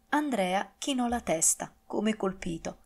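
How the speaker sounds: ordinary delivery, nothing marked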